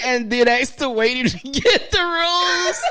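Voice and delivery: high laughing retelling. Real, not acted. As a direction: squeal it out through laughter.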